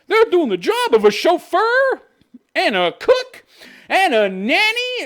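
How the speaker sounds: high, slow sarcastic sing-song; mock the magazine's list with a laugh. Ranting about a magazine, mocking its claim with laughter.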